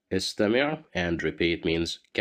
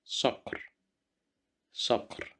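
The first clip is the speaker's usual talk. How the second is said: صقر whispered softly; whispered word for listening; whispered model word; 6 semitones higher, 5 dB quieter than usual. Shown as a demonstration. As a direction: breathe صقر out for the learners. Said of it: "you will listen to me".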